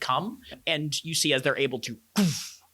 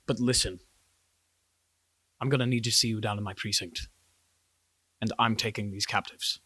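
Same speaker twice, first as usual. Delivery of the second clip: lower, controlled officer's voice; authoritative guard chief; 3 semitones lower, 3 dB quieter, faster than usual. Acted. Guard chief claiming captives from the investigators, low and authoritative. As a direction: lay down orders with measured pauses.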